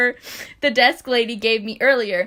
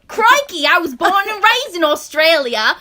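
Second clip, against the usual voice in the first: higher, louder hammed-up Australian accent; 6 semitones higher, 7 dB louder than usual. Reading a fanfic line on request, in a hammed-up Australian accent. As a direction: play up the Australian accent for the listener. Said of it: "Can you please read it in an Australian accent?".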